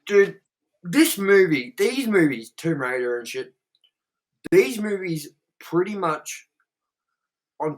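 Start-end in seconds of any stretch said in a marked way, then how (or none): none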